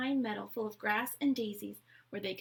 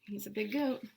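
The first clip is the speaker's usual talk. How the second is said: ordinary delivery, nothing marked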